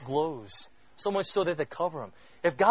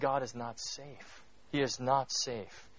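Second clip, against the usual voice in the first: slow, weighty "not safe"; slow, soft solemn warning; solemn emphasis; 3 semitones lower, 8 dB quieter, slower than usual. Real, not acted. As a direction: lean hard on not safe, slowly. Solemn sermon point.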